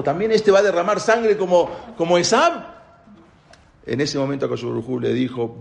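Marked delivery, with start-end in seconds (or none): 0.0-2.7 mildly acted alarm, raised pitch. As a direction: exclaim higher in dismayed disbelief. Prophet alarmed at seeing the redhead.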